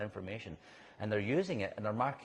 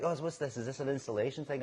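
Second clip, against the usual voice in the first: imitated curious American, higher pitch; puzzled Americans; 6 semitones higher than usual. American castmates asking about the cones, mock puzzled. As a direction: lightly mimic a puzzled arty question. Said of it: "I've got some Americans".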